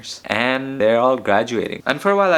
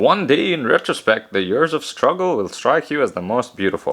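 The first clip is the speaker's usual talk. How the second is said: put-on quoting Freud in a rough Austrian accent; 2 semitones higher than usual. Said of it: "in an Austrian accent or something".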